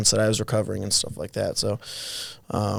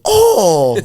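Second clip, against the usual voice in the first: high, loud mock shocked Oh; shocked gasp; 9 semitones higher, 10 dB louder than usual. Teammates see the broken collarbone, mock shocked. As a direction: gasp like seeing a gruesome injury. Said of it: "they look at me and go".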